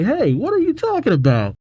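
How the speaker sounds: high-pitched indignant cheerleader. Acted. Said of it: "One of the cheerleaders say".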